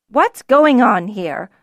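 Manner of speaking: loud, slow demanding call. Acted. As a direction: call across the room sternly. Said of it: "a woman's voice called".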